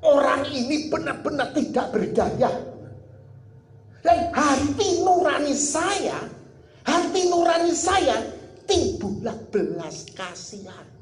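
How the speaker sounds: slow, drawn-out preaching swell; impassioned preaching. Real, not acted. Preacher heightens the testimony. (khotbah penuh perasaan, lambat dan ditekankan)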